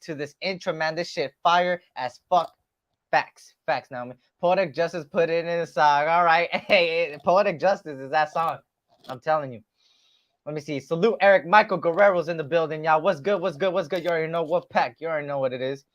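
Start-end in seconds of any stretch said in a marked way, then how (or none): none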